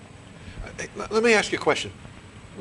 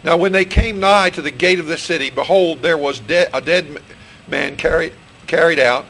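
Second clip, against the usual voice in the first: scripture reading; 5 dB louder than usual. Real, not acted. Preacher reading Luke seven to his congregation, solemn and loud. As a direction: read it out weightily to the congregation.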